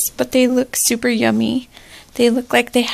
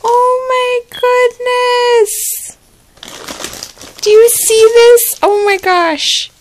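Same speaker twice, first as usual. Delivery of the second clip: high, slow squeal of delight; excited gasp; 8 semitones higher, 4 dB louder than usual. Real, not acted. Gift opener squealing at the surprise, high and slow. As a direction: draw out the oh in pure delight.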